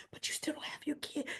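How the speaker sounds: imitating Drew's hushed whisper; mock whisper. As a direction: hiss it softly to unsettle her. Drew whispering again.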